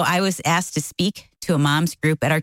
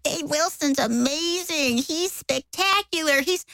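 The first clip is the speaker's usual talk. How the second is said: gushing admirer; 11 semitones higher, 4 dB quieter than usual. Retold or imitated. Church gushing over Dave.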